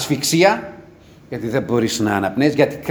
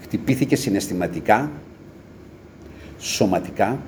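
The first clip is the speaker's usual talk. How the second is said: normal talking voice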